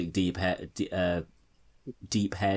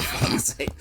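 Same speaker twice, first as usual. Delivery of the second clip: muttered curse. Retold or imitated. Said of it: "Starmer ... he's just like".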